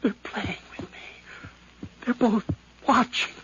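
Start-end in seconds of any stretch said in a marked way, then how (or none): 0.2-1.8 slow, hushed, fearful whisper; paranoid whisper. Acted. Nephew whispering his fear of the inspectors. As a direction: let it slip out under the breath. Killer paranoid under questioning.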